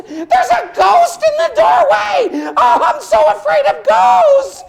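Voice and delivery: high, loud, slow frightened shout; terrified horse cry. Acted. Horse cries out at the ghost in the doorway, high and loud. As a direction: wail in fear at the ghost.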